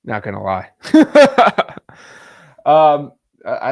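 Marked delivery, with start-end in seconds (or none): 0.8-2.3 big burst of laughter; loud laugh. Real, not acted. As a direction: crack up loudly. Reacts to realistic AI call.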